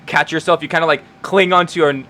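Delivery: ordinary talk, nothing marked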